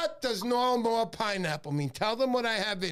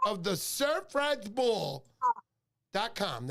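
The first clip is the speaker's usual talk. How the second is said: slow plug; slower than usual. Real, not acted. Plugging a website.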